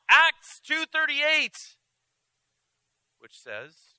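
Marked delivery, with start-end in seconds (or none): 0.1-1.8 shouted scripture. Acted. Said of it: "she yelled".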